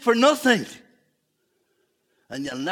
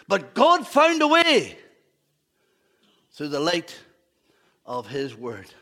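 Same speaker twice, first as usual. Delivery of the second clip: slow emphatic preaching; 3 semitones lower, 5 dB louder, slower than usual. Acted. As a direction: lean hard on it, slowly.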